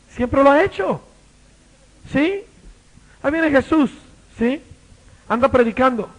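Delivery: loud, high preacher telling the story; fiery preaching. Acted. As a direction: preach it at full force. Preaching at full force. (predicación fogosa a todo volumen)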